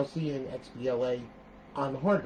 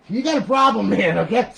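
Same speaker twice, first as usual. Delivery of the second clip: loud, high-pitched scolding; emphatic outburst; 8 semitones higher, 10 dB louder than usual. Real, not acted. Tutorial host telling doubters off, loud outburst. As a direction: raise your voice at the doubters. Heated warning to doubting viewers.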